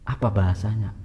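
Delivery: usual speaking voice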